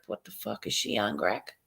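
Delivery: mock confused whisper. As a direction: whisper it like a baffled stranger. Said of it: "people would be like".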